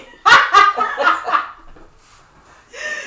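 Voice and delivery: high loud cackling laugh; loud burst of laughter. Real, not acted. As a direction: howl with laughter. Big laugh at joke.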